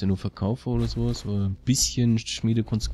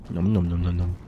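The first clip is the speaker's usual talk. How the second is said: nom nom chomping; 4 semitones lower than usual. Pretend. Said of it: "Hühnchenbrustfilet".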